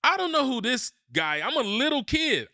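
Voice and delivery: put-on high, excited kid voice; little kid voice. Remembering himself as a small boy at the game, high kid voice. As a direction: sound like a wide-eyed little boy. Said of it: "And I'm like".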